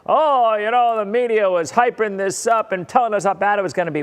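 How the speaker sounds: loud, high, fast mocking whine; mocking the doubters who say the media hyped the storm; ridiculing voice. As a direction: mimic the scoffers with a sneer. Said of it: "my ridiculing voice".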